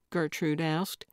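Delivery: ordinary delivery, nothing marked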